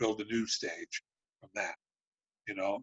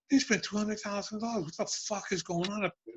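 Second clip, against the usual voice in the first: retold outrage; 10 semitones higher than usual. Retold outrage at studio spending.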